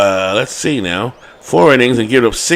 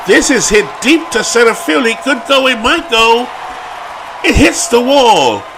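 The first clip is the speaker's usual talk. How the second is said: higher and louder, yelling the ball toward the wall; excited shout; 8 semitones higher, 5 dB louder than usual. Real, not acted. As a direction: call it like it might leave the park. Deep drive nearly out.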